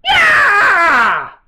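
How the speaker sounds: very high, loud shouted yelp; Howard Dean yeah. Retold or imitated. Host echoes the clip with his own Howard Dean yell. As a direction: scream it like the famous clip. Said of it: "practicing my Howard Dean impression".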